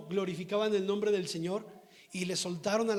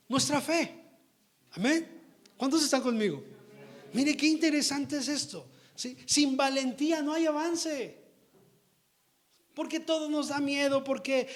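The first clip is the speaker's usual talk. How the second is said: higher, louder preaching at full force; fiery preaching; 4 semitones higher, 5 dB louder than usual. Acted. Preacher exhorting his congregation, fiery and raised.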